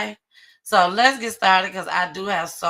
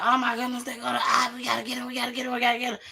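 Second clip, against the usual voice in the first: higher, urgent run-on repeats; lightly acted scramble, pitched up; retold urgency; 6 semitones higher, much faster than usual. Shooters spotting their rival, retold with urgency. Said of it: "They said".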